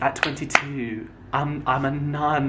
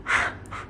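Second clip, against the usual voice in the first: soft laugh; 5 dB quieter than usual. Real, not acted. Laughs at own story.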